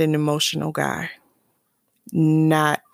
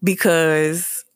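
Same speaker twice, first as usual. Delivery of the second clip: ordinary talk, nothing marked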